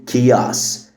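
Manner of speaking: "كياس" as "kay-os", starting on K; silent H, C as K. Shown as a demonstration. Teacher models chaos, "كياس" starting with a K sound. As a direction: say "كياس" with a hard K. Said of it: "ال H silent وال C بتتنطق K".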